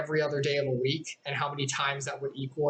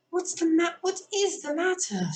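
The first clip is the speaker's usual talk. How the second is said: much higher, slow worried question; a high, startled girl; anxious Alice; 13 semitones higher, 3 dB louder than usual. Acted. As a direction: ask in alarm.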